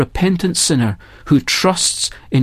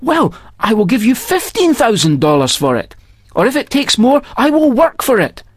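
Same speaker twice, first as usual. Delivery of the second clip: raised, insistent offer; a hint of an eager buyer; eager missionary; 7 semitones higher than usual. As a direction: press to buy it, pitched up. Missionary bargains for pearl.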